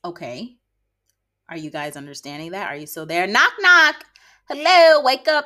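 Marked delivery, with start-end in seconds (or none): 3.3-5.4 mock wake-up call. Host calls to listeners who tuned out, loud and high. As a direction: knock knock called out loud and high.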